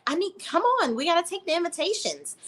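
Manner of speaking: high impatient whine; mock nagging bride. Mocking Amanda's demand.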